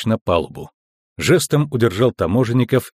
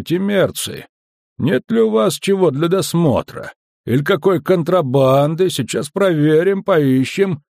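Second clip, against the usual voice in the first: higher-pitched, deliberate officer voice; measured, distinct warning; 7 semitones higher than usual. A narrator's reading. Customs officer warning intruders, measured and distinct. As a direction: spell out the threat clearly. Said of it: "цедил слова медленно и чётко".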